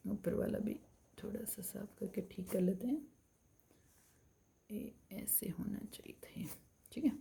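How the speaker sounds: soft whispered aside to herself while erasing; real whisper while correcting the drawing; muttered aside. Real, not acted. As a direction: murmur under your breath, not for the class. Teacher mutters while fixing drawing.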